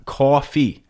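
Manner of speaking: New York "coffee", vowel like AW. Shown as a demonstration. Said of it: "is how it sounds".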